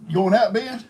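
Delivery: high, loud call across the yard; calling out to Ben outside. Real, not acted.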